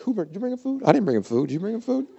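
high, bickering disciples trading questions; hammed-up squabbling about the food; confused disciples. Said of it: "I love the part where they're like".

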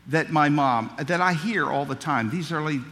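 normal talking voice

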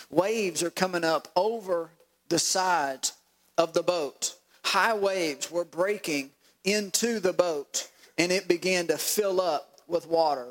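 slow, deliberate scripture reading; drawn-out words with pauses between phrases. Shown as a demonstration. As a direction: read it slowly, letting each phrase land. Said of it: "Slow down when you read this".

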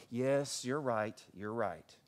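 low, soft, giving in; meek concession. Retold or imitated. Whining husband conceding to his wife, meekly acted.

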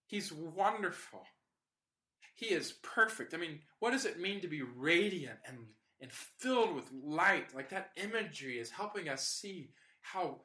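normal talking voice

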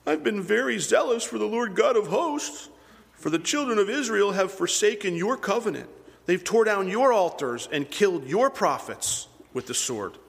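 high, whining complaint to God; whiny voice. Retold or imitated. Elijah complaining to God, in a high whiny voice. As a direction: whine it like a self-pitying prophet. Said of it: "I just hear a whiny voice here".

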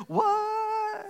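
very high, stunned what?; disbelieving squeak from the generals; baffled what. Retold or imitated. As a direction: generals can't believe the plan. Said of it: "And you know what they did?".

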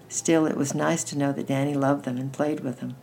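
ordinary delivery

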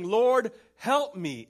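loud, high cry for help. A narrator's reading. Woman kneeling before Jesus, loud cry for help. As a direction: cry out the plea with full force. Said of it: "as she cried out".

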